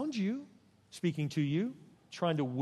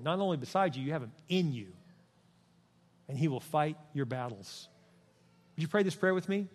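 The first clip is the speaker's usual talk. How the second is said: ordinary talk, nothing marked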